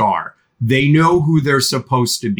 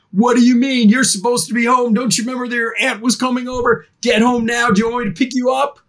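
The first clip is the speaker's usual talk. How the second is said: higher, fast scolding mom shout; mock angry mom; 9 semitones higher, faster than usual. Mom answering the code word text, fake-furious and scolding. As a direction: bark it like a mom ordering you home. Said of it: "my mom knows to text back".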